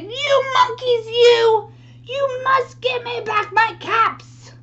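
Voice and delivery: high, loud storybook yell; angry shout. Acted. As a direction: shout it like a peddler at the end of his patience. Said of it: "stamped both of his feet and shouted".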